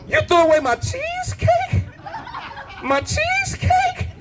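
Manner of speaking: shrill-high mock shriek of disbelief; outraged disbelief. Husband learns wife trashed his cheesecake, mock shriek. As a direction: screech it like the world just ended.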